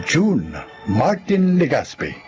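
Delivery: ordinary delivery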